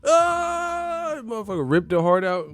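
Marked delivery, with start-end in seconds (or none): 0.0-1.2 high, sustained mock chant; long, high-pitched cult chorus "ah"; chanting crowd wail. Streamer imitates everyone chanting, high held wail. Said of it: "Everyone was chanting".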